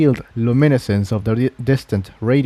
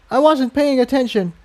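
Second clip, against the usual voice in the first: higher, apologetic mare voice; young mare; 13 semitones higher than usual. Acted. Mare apologising to the scarlet unicorn, sheepish. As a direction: own up sheepishly.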